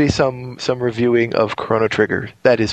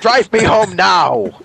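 slow, louder, fed-up order; exasperated demand; 5 semitones higher, 4 dB louder, much slower than usual. Retold or imitated. Freezing host demands cameraman drive him home, exasperated. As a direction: spell out each word, furious and freezing.

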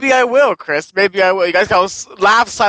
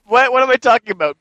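ordinary delivery

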